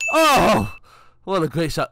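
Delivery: sudden high, loud exclamation; amazed shout. Real, not acted. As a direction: explode at the great shot. Teammate makes great shot.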